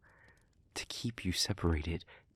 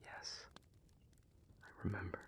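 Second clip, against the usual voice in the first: very soft, halting, nearly under the breath; subdued admission; 17 dB quieter, faster than usual. Acted. Speaker admits to partner that they remember, subdued. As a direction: admit it quietly, with pauses.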